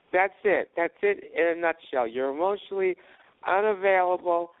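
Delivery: loud high nagging complaint; yelling at him in mock outrage; angry girlfriend rant. Girlfriend yelling at him during breakup, high and loud. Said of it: "going off on me".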